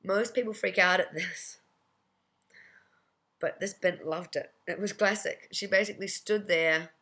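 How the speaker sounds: usual speaking voice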